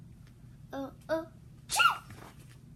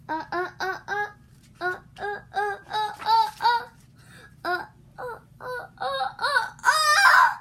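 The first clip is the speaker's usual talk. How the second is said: rising gasping uh-uh to a wail; hammed-up sneeze build-up; building sneeze wind-up; 7 semitones higher, 14 dB louder than usual. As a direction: build the sneeze higher and louder.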